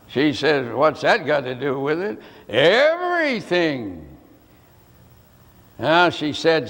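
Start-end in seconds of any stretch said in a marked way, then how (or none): 0.7-2.2 low, quick echo with a rising end; echoed question. Retold or imitated. Mother repeats her son's question to him, echoing it. 2.5-4.1 "Everything" drawn out slowly, pitch swelling and then falling; emphatic answer. Retold or imitated. Mother answers her doubting son, "Everything" drawn out emphatically.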